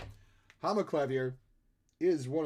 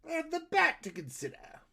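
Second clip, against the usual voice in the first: hammed-up villain voice, pitched high; swooping theatrical supervillain, leaping up high; Joker; 8 semitones higher than usual. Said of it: "I sound like the Joker".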